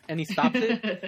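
normal talking voice

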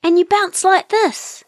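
high-pitched, excited bird voice; excited little kiwi. A narrator's reading. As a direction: exclaim it high, all excited. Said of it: "all excited".

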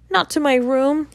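high, begging plea; a boy pleading, pitched high. A narrator's reading. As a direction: beg not to be sent away. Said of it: "he pleaded".

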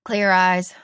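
loud coach's motto; TV football coach. Retold or imitated. Host recalls Friday Night Lights coach's saying, coach impression. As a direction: call it out like a coach. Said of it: "What's Coach always say?".

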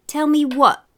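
high, loud demanding question; mother calling out. A narrator's reading. Mother overhears the children, demanding call.

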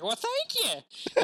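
put-on high, slow creaky old man. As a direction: croak it out like a grandpa.

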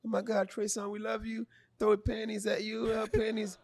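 put-on high, soft gushing girl voice; gushing female fan. Fan posting on a singer's birthday, gushing high mock voice. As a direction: swoon over him like a lovesick fan. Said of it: "and the girl's like".

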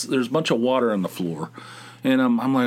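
ordinary talk

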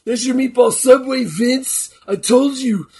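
loud, high, furious ranting; angry shout. Pretend. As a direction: yell it like a wrestler cutting a promo. Voicing a wrestler yelling at Vince.

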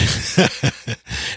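real amused chuckle; breathy high giggle. Real, not acted. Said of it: "I can't say it without laughing".

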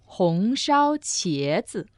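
slow, every tone of "红烧茄子" laid out; slow model. Shown as a demonstration. Teacher modelling the dish name, slow and deliberate. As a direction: spell "红烧茄子" out slowly for the learner. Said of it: "so how about braised eggplant?".

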